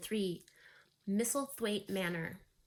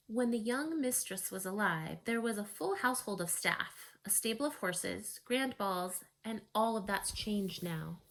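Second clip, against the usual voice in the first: ordinary delivery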